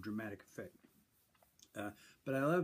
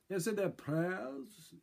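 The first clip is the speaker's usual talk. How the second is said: higher, slow stage delivery; mock Shakespearean declamation; 8 semitones higher, much slower than usual. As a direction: declaim it grandly to the river. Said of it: "then I would say".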